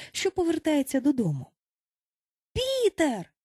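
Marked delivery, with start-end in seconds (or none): 2.5-3.3 put-on high-pitched boys' cry; excited exclamation. A narrator's reading. Boys greet Peter's return, high exclamation. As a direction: cry out the name high and long. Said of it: "хором вигукнули хлопчаки".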